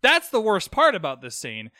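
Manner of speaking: usual speaking voice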